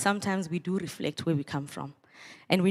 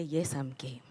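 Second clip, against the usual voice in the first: softer, lower answer; response line; 5 semitones lower, 8 dB quieter than usual. Shown as a demonstration. Speaker teaching students the reply, softer and lower. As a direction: give the reply back calmly. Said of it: "You say".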